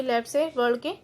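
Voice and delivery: normal talking voice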